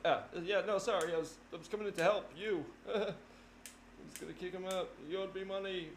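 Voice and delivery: high, fumbling nervous excuse. Retold or imitated. Caught sneaking up on the fighter, high nervous excuse. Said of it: "you're like".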